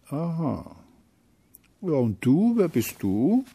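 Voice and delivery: high, rising small-character voice; curious little feeling. Acted. Little feeling questions Hate, high curious voice. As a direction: ask back high and inquisitive.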